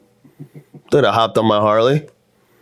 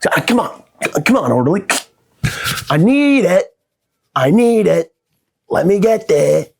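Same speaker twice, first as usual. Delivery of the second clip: higher, whiny pleading for the phone; begging addict; 8 semitones higher than usual. Acted. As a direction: beg the orderly like a desperate addict. Begging the orderly in rehab.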